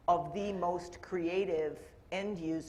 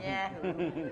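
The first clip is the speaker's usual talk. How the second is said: laughing Yahoo! in a Canadian accent; 2 semitones higher than usual. Real, not acted. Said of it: "that my Canadian accent showing".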